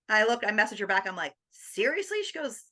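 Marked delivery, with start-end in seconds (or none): 1.5-2.2 high, doubting question; doubting her daughter's praise, pitched high; incredulous. Retold or imitated. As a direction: ask in total disbelief. Doubting text reply.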